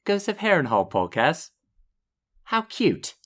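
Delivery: posh English lady voice, rising on how cute; English accent. Retold or imitated. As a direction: mimic an English lady admiring the shirt. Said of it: "that was my English accent".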